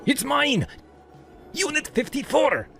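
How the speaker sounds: very high, indignant rant; ranting shout. A narrator's reading. Man being cuffed insisting on his unit, ranting shout. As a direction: shout your claim in outrage. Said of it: "while he ranted".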